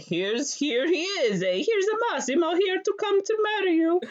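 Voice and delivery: high, hammed-up Italian accent dad; lightly faked Italian accent, proud dad showing off the groom; mock Italian dad. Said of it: "and he's like".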